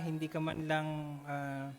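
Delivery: normal talking voice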